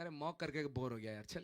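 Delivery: low, quiet, rapid aside as the devil; offhand, dismissive mock devil; bored devil. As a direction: toss it off like a devil tired of mocking. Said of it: "devil has started mocking you".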